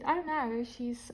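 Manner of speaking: ordinary delivery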